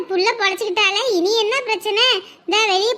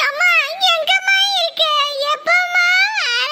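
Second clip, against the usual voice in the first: put-on high, tearful child calling his mum; crying child; 8 semitones higher than usual. Little boy crying to his mother on the phone, high and tearful. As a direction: whimper for mum to come home. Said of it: "ஏன்டா அழுவுற இப்படி?".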